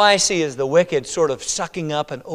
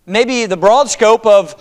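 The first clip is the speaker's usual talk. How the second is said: ordinary delivery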